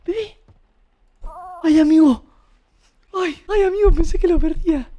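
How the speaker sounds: high frantic relief; high relieved cries. Real, not acted. Relieved to find the baby.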